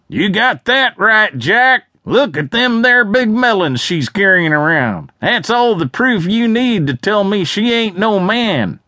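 high, loud jeering shout; loud heckler call. A narrator's reading. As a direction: bellow the crude joke to the crowd. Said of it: "someone called out loudly".

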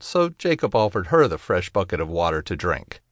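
ordinary delivery, nothing marked

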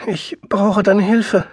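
slow, high, tearful plea; sobbing. A narrator's reading. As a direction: beg for help through sobs. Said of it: "Jetzt schluchzte sie".

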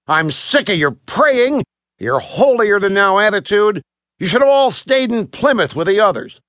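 acted exasperation, pressing each complaint; fed-up sailor. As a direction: rail at them, sick of the lot. Sailor rails at the Puritans.

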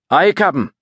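put-on Scottish engineer's Aberdeen accent; rolled Scots brogue on "Aye, Captain". A narrator's reading. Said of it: "His robust Aberdeen accent".

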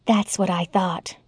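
soft, low confiding tone. Acted. Young woman confiding to ex-wife, low voice. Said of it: "she said in a low voice".

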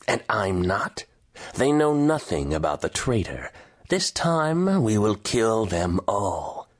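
measured threat, lightly acted, drawing out the final vow; menacing general. A narrator's reading. General voiced in story dialogue.

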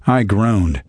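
usual speaking voice

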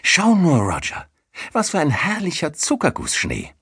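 high, joyful exclamation; jubilant. A narrator's reading. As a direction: cry out with delight at the snow. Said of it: "jauchzte vor Freude". (vor Freude jauchzend)

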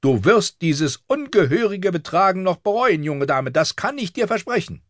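very high-pitched angry threat; outraged governess scolding; furious septa. A narrator's reading. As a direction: promise punishment through the door. Septa threatens girl through door.

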